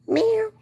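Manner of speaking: very high, quick cat meow; playful meow. Pretend. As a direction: toss off a cute meow. Sign-off meow to viewers.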